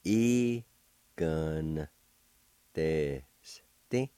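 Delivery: slow, drawn-out syllables with pauses between. Shown as a demonstration. Teacher showing learners how to pronounce the word, syllable by syllable. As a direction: hold each part of "Egon. Teste." separately. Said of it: "pronounce the N sound with the G V like this".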